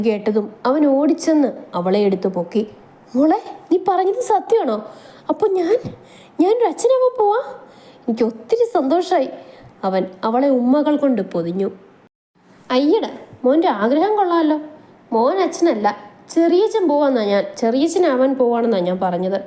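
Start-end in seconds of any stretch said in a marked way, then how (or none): 3.1-9.3 much higher, thrilled husband; overjoyed. Acted. Manu thinks he will be a father, much higher and overjoyed. 12.8-19.4 higher, playful mocking wife; teasing wife. Mahi teases Manu about his hopes, higher and playful.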